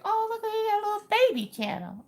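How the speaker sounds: put-on very high, teasing baby-talk voice; cutesy cooing. Gushing to a crafter about her baby channel, cutesy cooing. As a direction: coo it in a tiny high voice.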